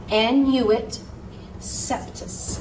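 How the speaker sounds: annuit septus, septus nearly whispered; careful pronunciation. Shown as a demonstration. Teacher shows how annuit coeptus should sound. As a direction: model annuit septus syllable by syllable. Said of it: "should be pronounced".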